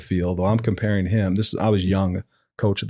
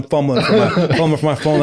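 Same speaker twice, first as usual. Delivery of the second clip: higher, laughing as he talks; laughing excitedly; 7 semitones higher, 5 dB louder, faster than usual. Real, not acted. Coach scrambles for his phone after the dunk, laughing. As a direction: chuckle through the scramble.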